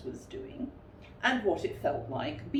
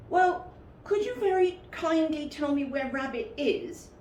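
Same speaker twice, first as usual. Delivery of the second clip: higher, politely asked; polite Pooh; 9 semitones higher, 3 dB louder than usual. Acted. Pooh asking the voice where Rabbit is, polite and higher. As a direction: ask very kindly.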